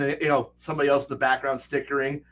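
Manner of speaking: ordinary talk, nothing marked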